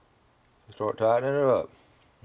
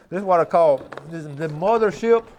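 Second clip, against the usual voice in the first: excited show-off; 6 semitones higher, 8 dB louder than usual. Real, not acted. Proudly shows off his tackle box.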